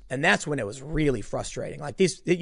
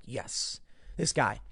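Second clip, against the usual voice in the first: whispered, softer pride; hushed proud aside; 4 semitones lower, 9 dB quieter, much slower than usual. Retold or imitated. Praising his buddy on the ground, hushed proud whisper. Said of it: "I was like".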